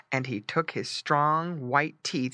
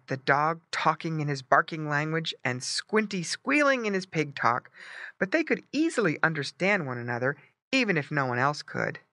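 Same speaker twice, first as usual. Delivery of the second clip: ordinary delivery, nothing marked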